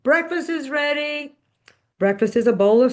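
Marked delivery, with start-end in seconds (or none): 0.0-1.3 mild narrated yell, pitched up; mom calling out. A narrator's reading. Mom calling the family to breakfast from the kitchen, mild high-pitched yell. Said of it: "Mom yells from the kitchen".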